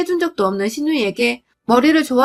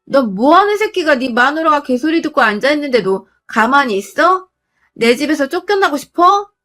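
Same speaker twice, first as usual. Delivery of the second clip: higher, raised-voice scolding; acted furious telling-off; angry yell; 4 semitones higher, 4 dB louder than usual. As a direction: tear into a husband, voice pitched up. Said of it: "하면서 소리를 꽥 지르니".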